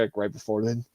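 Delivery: ordinary delivery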